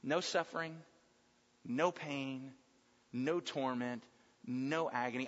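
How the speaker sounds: low, hushed temptation with long pauses; quiet, insinuating devil's offer; Satan tempting. Acted. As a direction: tempt softly, dropping low and letting each promise hang. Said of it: "he heard the words of Satan".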